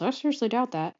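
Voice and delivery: lightly imitated muttered doubt; mild mumble. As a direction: mumble it under your breath. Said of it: "Rapunzel mumbles".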